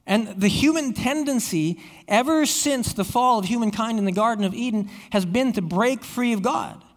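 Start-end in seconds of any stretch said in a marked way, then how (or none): none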